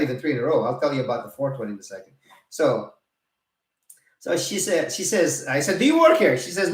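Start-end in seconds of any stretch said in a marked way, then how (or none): 5.8-6.4 high, loud indignant challenge; louder, higher mock outrage; retold angry retort. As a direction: snap back at a busybody. Retold confrontation with woman.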